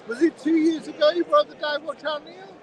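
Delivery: calling across a busy stand to the watchmaker. Real, not acted.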